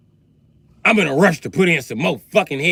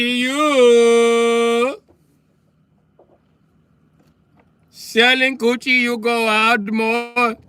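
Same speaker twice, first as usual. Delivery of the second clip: Indian man voice; 5 semitones higher, slower than usual. Acted. Indian man voice, sung-like bit.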